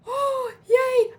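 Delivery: gasping, high, loud yay; a sudden squeal of joy; delighted gasp. Real, not acted. Delighted it is raining.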